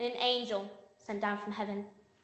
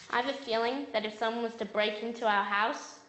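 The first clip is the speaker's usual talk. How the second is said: normal talking voice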